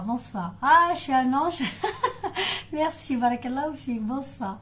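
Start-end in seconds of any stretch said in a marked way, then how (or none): 1.7-2.7 short breathless laugh, pitched up; high airy giggle. Real, not acted. Laughing to viewers after calling herself an angel. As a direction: giggle at her own joke.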